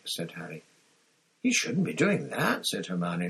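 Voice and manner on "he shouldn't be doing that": high-pitched, scandalised objection; indignant, swooping up high; shocked disapproval. A narrator's reading. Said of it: "looking very shocked".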